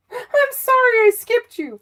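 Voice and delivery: very high, put-on sorry voice; high-pitched, over-sweet sorry; mock pleading apology. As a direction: squeal a playful apology way up high. Playful over-apology to water signs.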